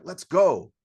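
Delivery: loud, high hyped-up shout; imitated hype cry, leaping up in pitch; excited cheer. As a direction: fire it out like a pumped crowd. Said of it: "They're, like, excited".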